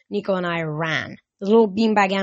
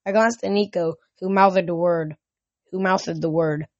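ordinary delivery, nothing marked

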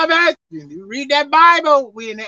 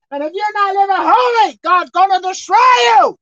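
raised, shouted sermon, pitched high; full-force preaching; 7 semitones higher, 5 dB louder than usual. Real, not acted. Preacher warning hearers who didn't get it, shouting. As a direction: preach it hard, driving up to a shout.

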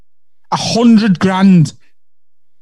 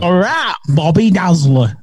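ordinary talk, nothing marked

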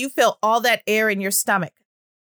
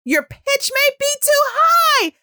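much higher, louder squeaky pitch; too high; 14 semitones higher, 4 dB louder than usual. Shown as a demonstration. Speech therapist demonstrating a voice pitched too high. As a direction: jump way up in pitch. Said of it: "another quality of it is the pitch".

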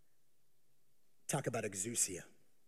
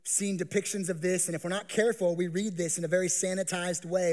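ordinary talk

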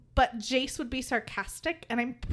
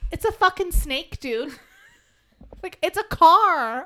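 higher, louder mock exasperation; exasperated retort; 6 semitones higher, 10 dB louder than usual. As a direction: retell it as a fed-up retort. Retelling her exasperation at her kid.

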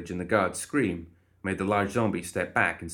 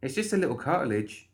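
amused dismissal; 7 semitones higher than usual. A narrator's reading. Molly to the howling guard, chuckling dismissively. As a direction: brush it off, pitched up and amused. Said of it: "Molly chuckled".

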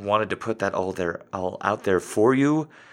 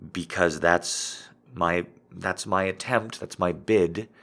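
normal talking voice

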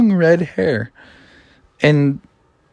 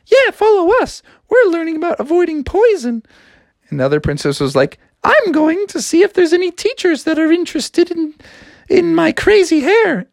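high, breathy-free lilting princess. Acted. Princess telling Froggy about her sisters, high voice. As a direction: play the upset princess in a high voice. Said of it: "the other princess were like".

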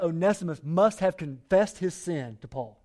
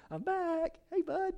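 much higher, awkward little "I'm back"; a nervous guy trying to play it cool; sheepish greeting; 3 dB quieter, slower than usual. Retold or imitated. Onesimus greeting the master he robbed, sheepish and higher. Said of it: "Put yourself in those shoes".

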